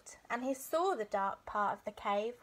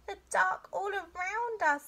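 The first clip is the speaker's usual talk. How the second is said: high small voice of a scared cub; high-pitched timid bear cub; little bear cub; 9 semitones higher, 3 dB louder than usual. A narrator's reading.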